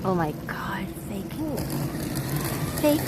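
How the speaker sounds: breathless whispered aside. Real, not acted. Reacting to something near her mid-story, whispering. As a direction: drop to a hushed startled whisper.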